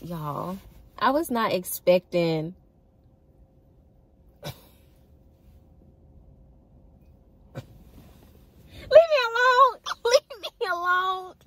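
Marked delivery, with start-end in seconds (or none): 4.4-11.3 giggly high squeal through laughter; bashful laughing protest. Real, not acted. Laughing, embarrassed by own crush.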